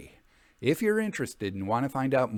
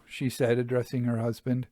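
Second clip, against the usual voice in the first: plain reading voice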